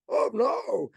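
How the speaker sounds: high-pitched wailing "oh no"; hammed-up cry of alarm; mock fearful dismay. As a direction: cry out in imitated panic. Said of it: "to them, they have fear".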